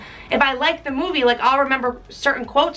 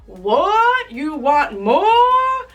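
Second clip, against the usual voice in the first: loud, slow outraged grown-up; mock stern orphanage master; mean master; 4 semitones higher, 3 dB louder, much slower than usual. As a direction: bark it back at the boy. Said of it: "the guy replies back".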